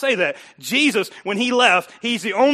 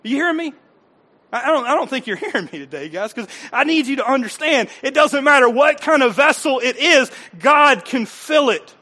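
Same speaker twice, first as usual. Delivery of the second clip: loud, rising preacher's cry; preaching at full force; 5 semitones higher, 6 dB louder than usual. Real, not acted. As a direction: drive it home to the congregation. Preaching climax.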